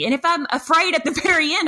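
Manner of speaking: usual speaking voice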